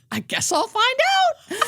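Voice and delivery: high, slow, laughing reply; a patient laughing at death, high and slow. Retold or imitated. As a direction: say it through a light laugh, resigned. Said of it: "laughed and goes".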